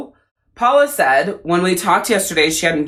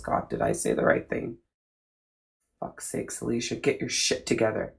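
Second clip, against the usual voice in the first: soft, half-whispered self-scolding; muttering to herself; 8 semitones lower, 11 dB quieter than usual. Real, not acted. Scolding herself over a word, in a hushed whisper. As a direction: hush it, annoyed at yourself.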